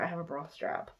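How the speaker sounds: ordinary talk, nothing marked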